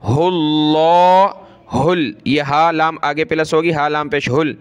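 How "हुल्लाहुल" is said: हुल्लाहुल with a full heavy dark ल्ला, slow; heavy Allah. Shown as a demonstration. Teacher shows the heavy Allah sound, slow recitation. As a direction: thicken the अल्लाह in हुल्लाहुल. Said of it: "अल्लाह को मोटा करेंगे".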